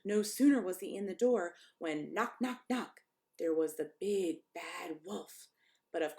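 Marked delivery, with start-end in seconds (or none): none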